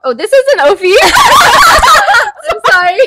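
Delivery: high, loud laughing outburst; laughing shout. Real, not acted. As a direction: burst out laughing at a slip. Laughing at her own mistake.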